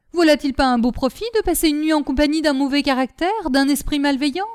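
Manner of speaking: heated, mocking retort; angry outburst. A narrator's reading. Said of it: "leur fit honte de leur emportement".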